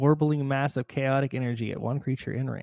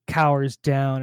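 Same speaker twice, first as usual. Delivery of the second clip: ordinary talk, nothing marked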